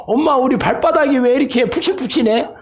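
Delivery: put-on high-pitched child asking mum; little one pestering mother with a question; baby camel. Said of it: "낙타 새끼가 엄마한테".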